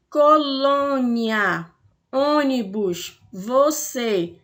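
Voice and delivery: Colônia drawn out slowly with the "ô" closed and held; closed vowel demo. Shown as a demonstration. Teacher showing pupils the closed vowel, slowly. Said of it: "a fala sai, é, em timbre fechado".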